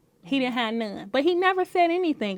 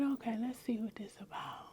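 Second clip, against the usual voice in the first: whisper; 2 semitones lower, 18 dB quieter than usual. Retold or imitated. Retold inner thought.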